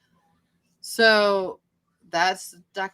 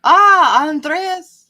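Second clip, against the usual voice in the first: calling out; 13 semitones higher, 12 dB louder than usual. Real, not acted. Calling out a name, delighted.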